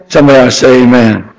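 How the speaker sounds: normal talking voice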